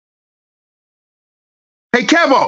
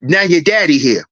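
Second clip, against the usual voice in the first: ordinary delivery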